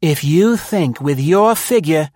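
high, loud, acid woman's voice; acidly. A narrator's reading. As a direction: cut him down with acid scorn. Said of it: "said Maud acidly".

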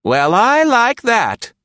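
high, loud and slow, huffy; high put-on voice, stretched in outrage; indignant. A narrator's reading. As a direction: take offence out loud. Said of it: "Nora commented indignantly".

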